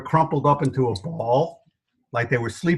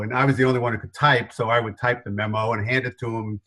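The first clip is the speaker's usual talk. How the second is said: ordinary talk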